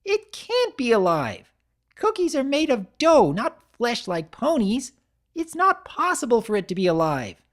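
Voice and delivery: high, loud, matter-of-fact insisting; insistent Pinkie. Acted. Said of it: "she explained".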